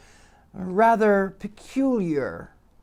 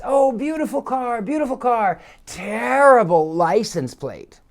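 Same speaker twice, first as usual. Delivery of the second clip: lightly mocking call from diners, higher pitch; raised diners' call; 6 semitones higher than usual. Diners at a table calling out to the passing driver, mock singsong. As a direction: call it out across the street in a mock voice. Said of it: "they raise their voice".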